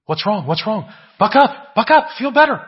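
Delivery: rising to a high, loud, chirpy mock cheer; mock cheerful pep talk. Preacher mimicking churchgoers who rush the sad, high mock cheer. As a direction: lampoon the pushy churchgoer who says cheer up.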